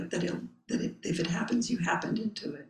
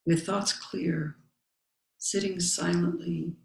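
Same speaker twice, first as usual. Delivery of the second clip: solemn text recitation. Acted. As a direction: recite each phrase with space around it. Reading the Zen text aloud.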